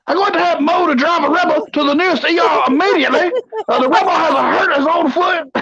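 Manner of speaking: put-on high, blustering old colonel; pompous sputtering military officer. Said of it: "do every top ten in the Colonel voice".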